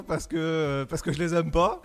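normal talking voice

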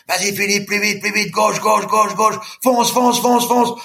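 high-pitched, driving shout; urging shout. Retold or imitated. Boatman urging the exhausted swimmer on, high-pitched and relentless. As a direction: push him on, call after call.

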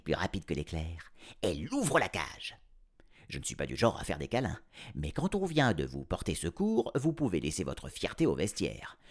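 plain reading voice

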